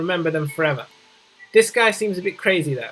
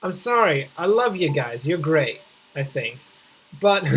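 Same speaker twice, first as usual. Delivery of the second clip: ordinary talk, nothing marked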